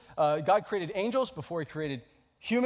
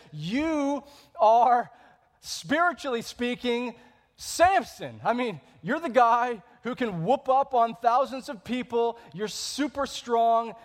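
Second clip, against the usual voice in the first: loud, higher, emphatic preaching; excited preaching; 6 semitones higher, 6 dB louder, slower than usual. Acted. Pastor paraphrasing the demon's words, loud and emphatic. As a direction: build it up with fervour. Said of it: "what this demon is saying".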